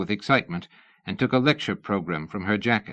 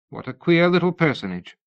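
a lightly voiced, higher, curious Lady Ada; polite interest in a stranger; 8 semitones higher, 3 dB louder than usual. A narrator's reading. Lady Ada asking Missus Tournachon about her name, curious.

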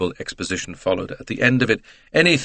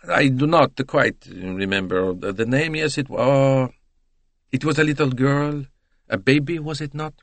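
put-on hesitant, higher Italian accent; Italian trying to remember; 5 semitones higher than usual. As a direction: grope for the memory, drawing words out. Voices Italian recalling case.

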